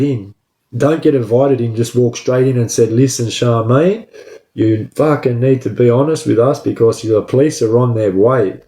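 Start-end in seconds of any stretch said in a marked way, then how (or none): none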